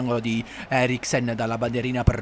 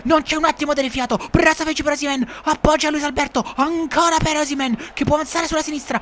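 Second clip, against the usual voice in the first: breathless italian goal commentary; 12 semitones higher than usual. Acted. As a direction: ride the counterattack into the goal. Counterattack goal call.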